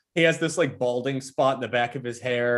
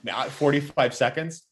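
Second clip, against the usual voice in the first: usual speaking voice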